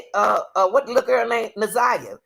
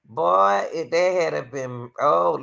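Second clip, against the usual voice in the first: ordinary delivery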